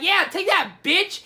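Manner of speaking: loud, high battle taunt; fighting taunt. Acted. Gandalf stabbing Balrog, loud mock fighting taunt. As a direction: act out a triumphant attacker.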